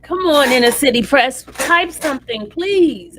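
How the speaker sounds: loud, impatient begging; exasperated pleading. Real, not acted. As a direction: plead loudly for an update. Begging a slow reporter to type.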